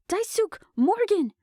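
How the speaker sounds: high, loud yell of two names; urgent shout to soldiers. A narrator's reading. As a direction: call them across the crowd. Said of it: "Genevieve yelled into the group".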